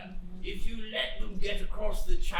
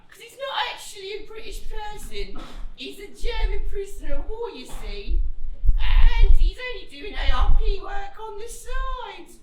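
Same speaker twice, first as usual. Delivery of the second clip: high, fast, rising to a shout; put-on voice; 14 semitones higher, 8 dB louder than usual. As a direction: rattle off the excuse, getting louder. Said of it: "and I would be like".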